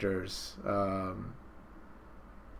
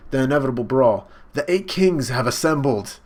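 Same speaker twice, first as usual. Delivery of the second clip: loud, raised announcing voice; dramatic proclamation; 3 semitones higher, 6 dB louder than usual. Retold or imitated. Announcing the kings' arrival, loud dramatic proclamation. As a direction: proclaim it grandly at raised volume.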